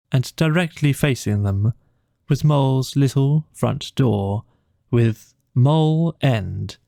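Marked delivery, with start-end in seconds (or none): none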